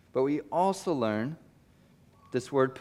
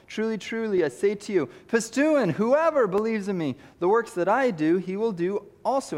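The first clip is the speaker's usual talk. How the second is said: preaching at full force; 6 semitones higher than usual. Acted. Preacher proclaiming John fourteen twelve at the climax. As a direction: build it up and proclaim.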